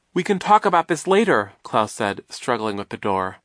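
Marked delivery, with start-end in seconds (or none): 0.1-1.5 high, loud, pressing voice; raised, insistent voice; urgent dismissal. A narrator's reading. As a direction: put it off urgently mid-struggle. Klaus speaks while struggling.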